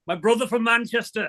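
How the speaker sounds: Manchester attempt sounding like Yorkshire; bad Manchester accent. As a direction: try a Manchester accent, land nearer Yorkshire. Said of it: "You sound like you're from Yorkshire".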